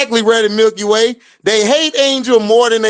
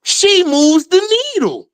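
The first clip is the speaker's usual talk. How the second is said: mock catchphrase; 6 semitones higher than usual. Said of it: "catchphrase repeated mockingly".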